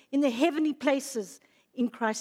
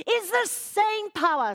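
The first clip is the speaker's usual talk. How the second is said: high, impassioned preaching; fervent, raised voice, hammering each phrase; very emotional; 6 semitones higher than usual. Real, not acted. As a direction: proclaim it with swelling emotion. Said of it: "I'm getting very emotional".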